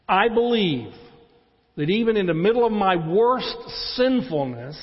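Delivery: loud, high, driving sermon climax; rising pulpit intensity, loud and urgent; full-force preaching. Acted. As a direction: preach it out with conviction.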